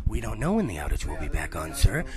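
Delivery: deep, flat drone of a call center agent; mock call center voice. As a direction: imitate a bored support rep, voice dropped low. Mocking call center worker voice.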